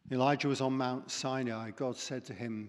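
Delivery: ordinary talk, nothing marked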